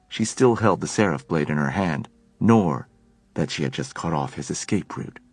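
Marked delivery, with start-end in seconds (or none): none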